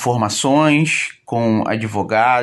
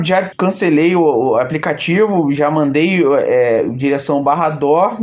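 ordinary talk, nothing marked

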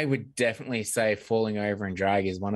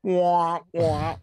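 muffled wah wah talk; 6 semitones higher, 5 dB louder than usual. Pretend. Mock dying sound effect.

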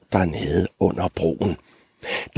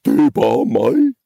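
raised, loud would-be commanding goat; trying to sound authoritative; 4 semitones higher, 5 dB louder than usual. Acted. Middle goat answering the troll, loud attempt at authority. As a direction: puff up and announce yourself firmly. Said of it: "forsøgte at lyde myndig".

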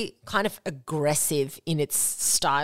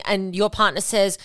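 normal talking voice

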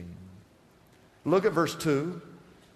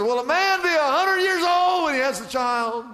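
high, raised voice of disbelief; incredulous Abraham; 7 semitones higher, 4 dB louder than usual. Acted. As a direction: act out astonishment at the absurdity. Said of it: "he goes, he goes".

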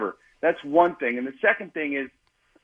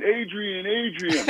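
Rocky yell; 6 semitones higher, much slower than usual. Retold or imitated. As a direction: call out like Rocky at the end, half-joking. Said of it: "I wanna yell".

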